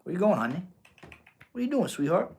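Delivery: high, soft pet talk; cooing to the cat. Real, not acted.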